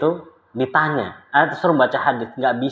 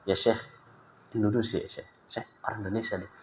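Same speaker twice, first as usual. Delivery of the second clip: hushed aside; 4 semitones lower, 13 dB quieter than usual. Retold or imitated.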